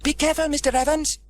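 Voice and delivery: put-on high child voices calling out; children calling. Children warning Trevor as he runs, high calling voices. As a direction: call a warning as kids. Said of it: "called the children".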